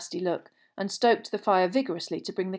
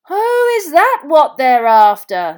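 loud, high, drawn-out realisation; surprised; 8 semitones higher, 15 dB louder, slower than usual. A narrator's reading. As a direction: boom it out as if it just dawned. Bagman reacts to Crouch's news.

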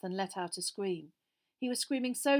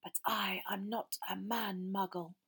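cold, barely audible; 4 dB quieter than usual. A narrator's reading. Master answering Frank's challenge, cold and barely audible. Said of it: "barely audible now over the crackling".

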